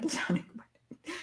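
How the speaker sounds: hushed, with a small laugh; soft laughing whisper; whispered aside. Real, not acted. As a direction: let it slip under your breath. Whispered aside about romance.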